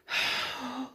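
soft, breath-filled gasp of dismay; wordless cry. A narrator's reading. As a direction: let out a long, soft gasp. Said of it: "cried Cucumber".

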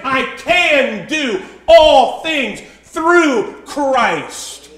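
slow, emphatic declaration. Acted. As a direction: stretch "all things through Christ" word by word. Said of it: "Paul could say so emphatically".

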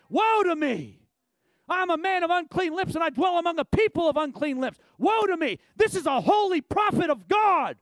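high, loud anguished cry of woe; Isaiah crying out. Acted. Isaiah fallen on his face, crying out in anguish. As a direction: cry out as the humbled prophet. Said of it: "he fell on his face".

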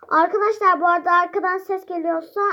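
talking loudly. Real, not acted. Host explains to viewers over noise, speaking loudly. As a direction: raise the voice over background noise. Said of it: "ben böyle yüksek sesle konuşmak zorundayım".

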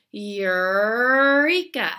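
drawn-out loud Eureka with pulled-back R. Shown as a demonstration. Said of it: "you're gonna say".

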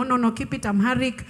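ordinary talk, nothing marked

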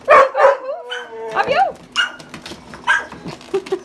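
high, slow sing-song dog talk. Real, not acted. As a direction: coo it up high to the puppy.

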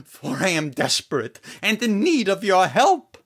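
earnest prayer. Acted. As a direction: beg the Lord for help. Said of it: "he got on his knees and prayed a special prayer".

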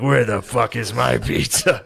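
slow, drawn-out indignant demand; mock outrage. Hungry man alone in a hotel demanding his order, mock outrage. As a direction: stretch it out like an aggrieved customer. Mocks himself as angry lone customer.

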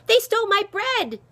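high, loud outraged cry; urgent high yell for help; screamed. A narrator's reading. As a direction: scream the accusation. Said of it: "she screamed".

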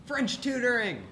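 high-pitched, punchy exclamation; excited announcement. Acted. Announces the next club segment.

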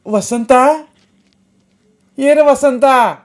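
a high, loud call stretched across the house; calling loudly. Acted. Mother calls her missing son, loud high call. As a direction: shout for the missing boy. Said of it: "मोठ्यानं हाक मारली तिनं".

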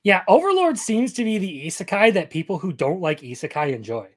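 usual speaking voice

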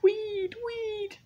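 tiny high-pitched squeak; very high, loud little call; squeaky high call. Acted. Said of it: "Little Weed called out".

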